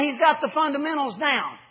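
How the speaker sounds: ordinary delivery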